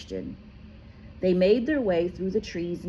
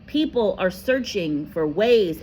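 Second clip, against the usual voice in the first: ordinary delivery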